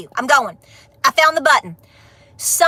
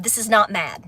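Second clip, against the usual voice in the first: not pressed firm and low, softer; stress on not; 7 semitones lower, 5 dB quieter than usual. Real, not acted. To critics who call her angry, a firm, low stress on not. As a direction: lean on not to insist she is calm. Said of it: "This is direct".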